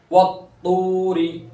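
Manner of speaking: वत्तूरी with a held, heavy त and long तू; slow वत्तूरी, settle firmly on the doubled त; firm full ta. Shown as a demonstration. Teacher demonstrates the full letter, वत्तूरी with firm heavy त. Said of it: "जमाओ के साथ और पुर करेंगे".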